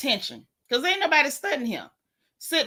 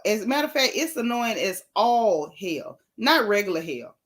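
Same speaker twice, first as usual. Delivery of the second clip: normal talking voice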